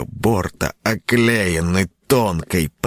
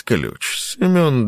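usual speaking voice

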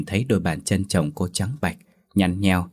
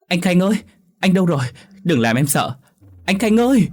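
much higher and louder, frantic calls; panicked yelling; 11 semitones higher, 5 dB louder than usual. A narrator's reading. Wife finds husband vanished, panicked screaming. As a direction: scream his name in terror. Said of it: "hoảng loạn mà gọi toáng lên".

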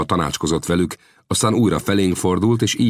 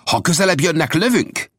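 high, raised threat to fire; shouted warning; 13 semitones higher than usual. A narrator's reading. Old Death warns the approaching Comanches, shouting. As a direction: call it out as a warning. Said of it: "kiáltotta Old Death".

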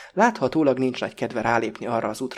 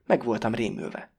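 plain reading voice

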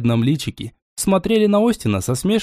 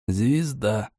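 terse; 4 semitones lower, 6 dB quieter than usual. A narrator's reading. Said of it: "скупо пояснил Остин".

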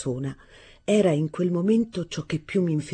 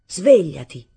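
sudden shout; 10 dB louder, slower than usual. Acted. Arturo waking his stepmother, sudden loud shout. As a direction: yell it loud and sudden to wake her. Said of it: "le gridai d'un tratto".

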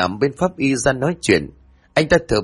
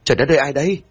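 startled; 4 semitones higher, much faster than usual. Acted. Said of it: "giật mình thót cả tim".

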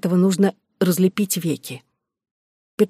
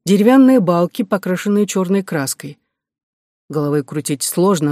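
ordinary delivery, nothing marked

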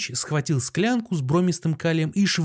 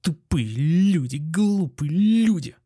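trembling repetition; 3 semitones higher, 3 dB louder, slower than usual. A narrator's reading. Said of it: "долго ещё повторял".

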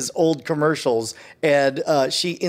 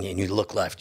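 normal talking voice

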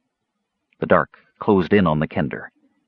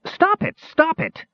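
repeated very high, panicked squeals; small character's frantic yelps; frantic cry; slower than usual. A narrator's reading. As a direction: plead desperately in a thin high voice. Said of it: "he cried frantically".

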